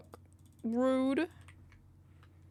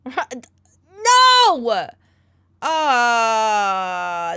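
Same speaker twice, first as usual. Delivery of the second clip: anguished no; 16 dB louder than usual. Real, not acted. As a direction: cry it out in dismay. Reacting to a bad outcome.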